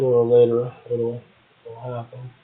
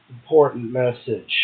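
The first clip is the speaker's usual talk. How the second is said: normal talking voice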